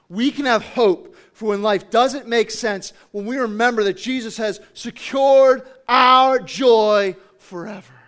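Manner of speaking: loud, emphatic build to a drawn-out finish; booming sermon proclamation; impassioned preaching. Real, not acted. Same takeaway repeated with force.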